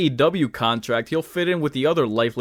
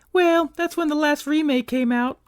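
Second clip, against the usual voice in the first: much higher dry reply; female character voice; 14 semitones higher than usual. Pretend. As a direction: answer in a high put-on voice. Voicing Claire reply.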